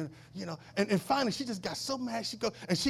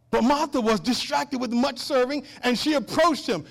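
loud, high proclamation of the verse; preached scripture reading; 6 semitones higher, 9 dB louder than usual. Real, not acted. Preacher reading Martha's story to the congregation, proclaiming. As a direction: read it out to the whole church.

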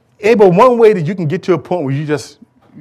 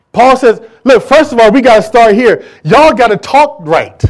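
loud, high preaching call; preaching at full force; 7 semitones higher, 10 dB louder than usual. Acted. Preacher urging the congregation, loud and high. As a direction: raise the voice and drive it home.

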